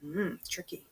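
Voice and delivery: ordinary delivery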